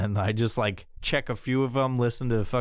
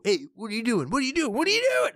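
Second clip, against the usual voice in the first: mocking pestering friends; 10 semitones higher, faster than usual. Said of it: "They wanna just fucking go".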